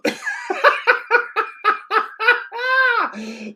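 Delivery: high, loud cackling laugh; helpless shrieking laugh; loud burst of laughter. Real, not acted. Keeper misses ball.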